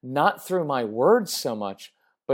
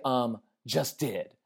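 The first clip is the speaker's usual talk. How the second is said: put-on softer, slow, deadpan teen retort; snarky teen comeback; 2 semitones lower, 7 dB quieter, slower than usual. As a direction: toss it off like a bored teenager. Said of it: "that's what my son said to me once".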